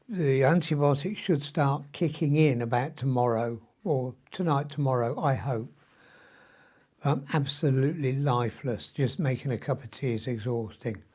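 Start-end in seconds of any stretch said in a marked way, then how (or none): none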